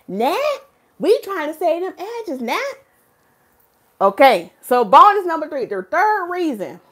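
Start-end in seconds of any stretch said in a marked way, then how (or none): 0.1-2.6 Tony Gaskins voice. Retold or imitated. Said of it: "In my Tony Gaskins accent voice".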